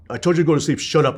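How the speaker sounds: imitating dad's loud, high-pitched scolding; scolding father. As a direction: act out an angry parent silencing a child. Acting out dad scolding his child.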